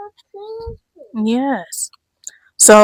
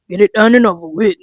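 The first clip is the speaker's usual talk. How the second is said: ordinary talk, nothing marked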